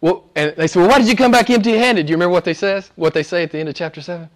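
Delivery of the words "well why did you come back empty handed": high, louder, quick acted challenge; imitated indignant questioning; mock indignant demand. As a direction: play officials demanding an explanation. Pharisees question the returning guards.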